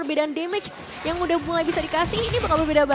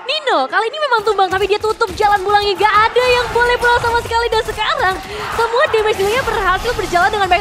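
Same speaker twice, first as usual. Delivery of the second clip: fighting game announcer; 6 semitones higher, 7 dB louder than usual. Acted. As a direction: shout the kill call to the crowd. Announcing a triple kill.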